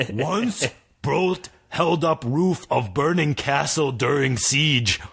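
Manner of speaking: very slow dim giant voice. Acted. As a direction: plod each word like a dumb giant. Giant speaks.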